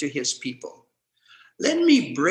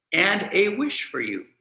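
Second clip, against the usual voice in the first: normal talking voice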